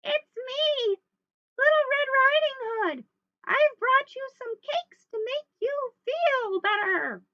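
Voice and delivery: loud, very high falsetto, hammed up; wolf's little-girl voice. Wolf tricks Grandma at the door, loud falsetto girl voice. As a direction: fake a little girl, squeaky high and drawn out. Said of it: "in his very best little girl voice".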